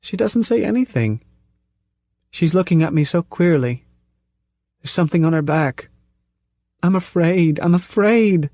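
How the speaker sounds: put-on high, trembling child's fear building to I'm afraid; frightened little girl. A narrator's reading. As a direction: speak as a child seeing something awful. Regressed woman speaks as frightened child.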